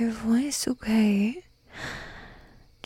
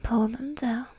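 whispered order. Acted. Mistress first whispering her order. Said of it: "You know exactly what I'm talking about".

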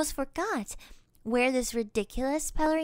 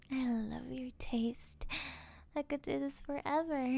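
intimate whisper; 4 dB quieter than usual. Acted. Intimate kiss moment.

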